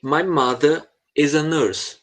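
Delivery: very slow, careful teacher read; very slow model line. Shown as a demonstration. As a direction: spell it out slowly for the student. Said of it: "Terceira fala". (leitura bem lenta e pausada)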